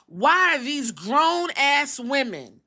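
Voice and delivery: GROWN-ASS WOMEN drawn out slow and high; high, drawn-out stress on "grown-ass women"; pointed emphasis. Real, not acted. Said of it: "there's a reason why I say it like this".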